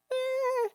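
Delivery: put-on high cooing sound to a crying baby; soothing coo. Shown as a demonstration. Mother cooing at her crying baby.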